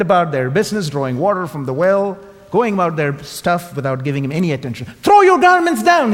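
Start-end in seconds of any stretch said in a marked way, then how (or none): 5.0-6.1 loud, much higher, indignant demand; cross. Acted. Proud donkey demanding homage from the crowd, cross and loud. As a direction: bray it out like an offended celebrity. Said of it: "he said crossly".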